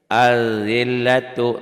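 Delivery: Az-zillatu chanted slowly and loudly, lam silent; recited Arabic example. Shown as a demonstration. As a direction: draw out Az-zillatu with a doubled z. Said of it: "tuh nggak dibaca". (Az-zillatu dibaca pelan, lam tidak dibaca)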